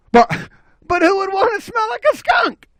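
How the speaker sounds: very high-pitched objection; squealed protest. Acted. Objecting to skunk smell, high squeaky protest.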